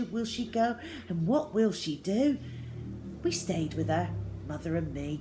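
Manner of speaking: plain reading voice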